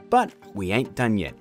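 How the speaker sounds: usual speaking voice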